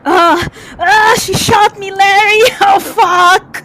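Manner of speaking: loud, high pained sobbing cry. Acted. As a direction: wail it out, shot and bleeding. Wounded man crying in pain.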